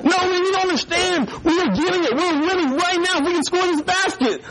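put-on very high, insistent kid fan; mock excitement. Boy pretending his team is winning, mock excitement.

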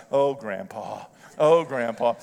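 a hint of a granddaughter despairing of grandpa; mock exasperation.

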